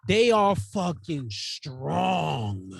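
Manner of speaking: very slow, stretched-out emphasis; slow emphatic awe. Real, not acted. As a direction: drag each word out for weight. Marveling at bodybuilders' strength, drawn out.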